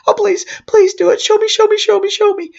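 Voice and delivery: put-on high, excited little boy begging; the boy pleading with the clown, high and eager; eager begging child. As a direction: bounce up high, begging to see it. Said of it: "kid begging excitedly".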